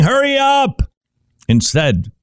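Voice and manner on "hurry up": high mock-impatient "Hurry up!"; impatient driver. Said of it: "always thinking".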